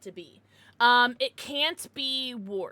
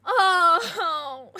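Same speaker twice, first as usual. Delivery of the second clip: a loud high cry of oh; overwhelmed gasp of emotion; emotional outburst; choked up; 6 semitones higher, 11 dB louder than usual. Real, not acted. Emotional moment, about to cry.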